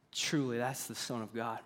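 hushed, low, barely voiced awe; understated trembling reverence. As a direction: say it softly, shaken with wonder. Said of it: "In a trembling voice".

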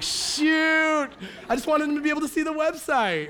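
high, loud comic whine of an excuse; mock defensive protest. Husband excuses brake check to his wife, mock defensive protest. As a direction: plead innocence in a hammed-up high voice.

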